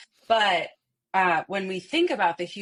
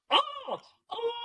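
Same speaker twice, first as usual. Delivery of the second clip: much higher hooting "Oh, oh"; peacock-style "oh". Shown as a demonstration. Coach shows students the peacock sound, much higher hoot. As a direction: open up the indignant peacock cry into a full sound. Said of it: "go into sounds to be like".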